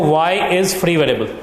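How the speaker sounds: slow, word by word for notes; slow dictation. Shown as a demonstration. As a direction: dictate each word slowly so students can write. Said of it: "लिखिए, therefore". (धीमे-धीमे बोलकर लिखवाना)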